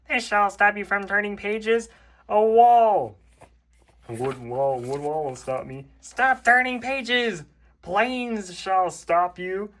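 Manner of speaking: high, slow dramatic Toad. Acted. Toad threatening the reader with walls and planes. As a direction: declare each barrier grandly. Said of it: "Toad voice".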